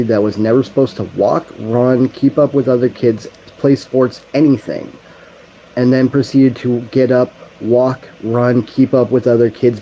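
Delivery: fervent loud sermon voice; preaching. Real, not acted. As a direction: preach it with building force. Preaching with rising force.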